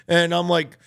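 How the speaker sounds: usual speaking voice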